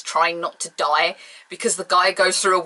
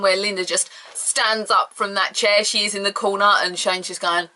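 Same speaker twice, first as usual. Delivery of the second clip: ordinary talk, nothing marked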